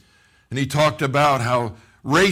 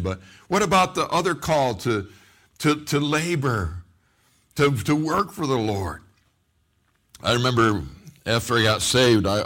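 usual speaking voice

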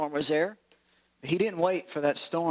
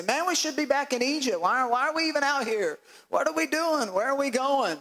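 high-pitched acted whining protest; exasperated, higher-pitched grumbling; grumbling complaint; 8 semitones higher than usual. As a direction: voice a fed-up crowd questioning the journey. Voicing grumbling Israelites in desert.

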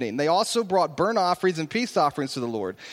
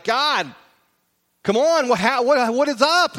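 rising, louder exasperated cry to God; exasperated plea; 6 semitones higher, 5 dB louder than usual. Retold or imitated. Preacher voicing Israelites complaining to God, exasperated louder cry. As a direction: throw up your hands at God, louder and higher.

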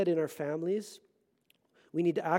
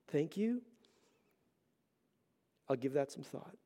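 much softer, calm and accepting; gentle reply; 4 semitones lower, 10 dB quieter than usual. Shown as a demonstration. To someone correcting him, a soft gracious reply. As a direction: model the humble answer, softly.

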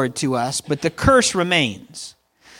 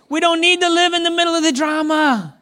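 much higher, ringing full-force preaching; a sustained preacher's cry; preaching peak; 10 semitones higher than usual. Real, not acted. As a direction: lift the whole line up high and hold it.

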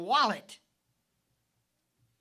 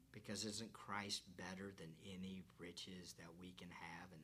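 soft, lower whisper; 12 semitones lower, 19 dB quieter than usual. Real, not acted. Preacher whispering to the congregation about Christ. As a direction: whisper it like a treasured secret.

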